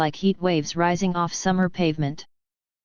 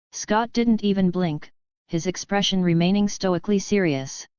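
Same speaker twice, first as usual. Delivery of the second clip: ordinary delivery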